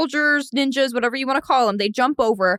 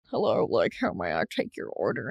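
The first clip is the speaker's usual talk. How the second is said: very low, softer posh waiter; 13 semitones lower, 7 dB quieter than usual. Acted. Waiter taking diner's order, low posh voice. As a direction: put on a deep, posh waiter voice. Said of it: "me being posh".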